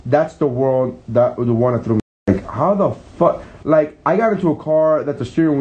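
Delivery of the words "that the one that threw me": normal talking voice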